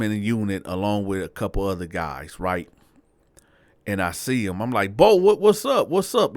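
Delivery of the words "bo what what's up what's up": high, loud call of recognition; excited greeting. Retold or imitated. Teller greeting Bo on the unit, excited. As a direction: hail him loudly, voice pitched up.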